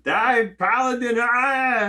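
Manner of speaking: high, slow, loud war shout; battle cry. Pretend. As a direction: roar it charging into battle. Acted paladin battle cry.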